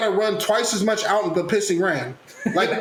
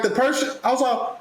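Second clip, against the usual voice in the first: usual speaking voice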